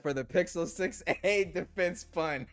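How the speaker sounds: reads the chat, breaking into laughter midway; laughing through a viewer's joke; laughing while reading. Real, not acted. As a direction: crack up on defense fund. Reading funny super chat aloud.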